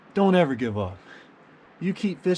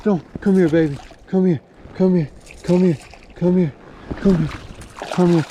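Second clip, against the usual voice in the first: high, excited repeated calls; urgent coaxing; 5 semitones higher, 3 dB louder than usual. Real, not acted. Angler calling the hooked fish to him, excited coaxing. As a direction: coax it in urgently, pitched up.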